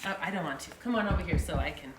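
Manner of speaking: plain reading voice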